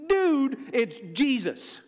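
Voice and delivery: high, loud burst dropping on the name; emphatic acted revelation. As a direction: announce yourself to a doubter, punchy and casual. Preacher voices Jesus revealing himself to Saul.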